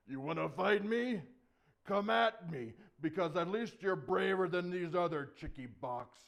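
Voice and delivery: loud, swaggering dare; challenging Goliath. Acted. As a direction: holler a scornful challenge.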